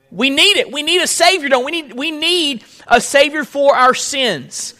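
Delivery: high, fervent plea for a Savior; fervent preaching. Real, not acted. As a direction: lift the voice in earnest appeal. Preacher raises voice.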